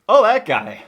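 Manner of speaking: high, loud "oh that guy" of recognition; recognizing. Retold or imitated. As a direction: spot a familiar face, delighted. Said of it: "and you're like".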